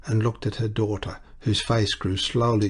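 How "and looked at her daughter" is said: plain reading voice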